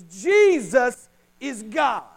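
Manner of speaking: Jesus is God, hammered home slowly; slow emphatic statement. Shown as a demonstration. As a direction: spell it out word by word. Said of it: "Break it down into simple language".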